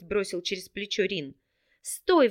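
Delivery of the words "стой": sharp high shout to stop him. A narrator's reading. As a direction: call out after him, loud and high. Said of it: "выкрикнула девушка". (громкий высокий окрик)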